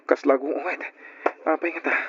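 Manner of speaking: usual speaking voice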